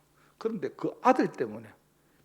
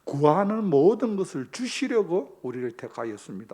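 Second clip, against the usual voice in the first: louder, rising sermon push; full-force preaching; 9 dB louder than usual. Acted. Pastor driving home the sermon point, louder preaching. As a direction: preach it out to the pews.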